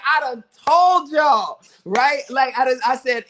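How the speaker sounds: high, loud, drawn-out I-told-you-so; gleeful gloating. Retold or imitated. Crowing to doubting friends after the winning shot, gleeful. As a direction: crow it out slowly in triumph. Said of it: "I'm happy, but I'm laughing".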